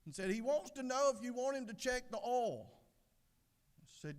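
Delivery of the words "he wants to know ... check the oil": high, insistent repeat; relaying husband. Acted. Husband relays the oil question to his wife, high and insistent. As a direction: pitch it right up for deaf ears.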